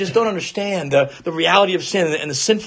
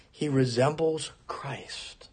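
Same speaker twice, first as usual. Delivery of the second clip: reverent whisper; 6 semitones lower, 11 dB quieter, slower than usual. Real, not acted. Reverent hushed climax of point.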